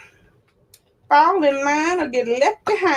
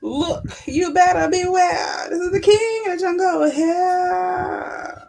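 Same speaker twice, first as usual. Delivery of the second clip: lion's threat; 5 semitones higher than usual. Acted. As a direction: bellow it like a proud lion. Lion roaring threat.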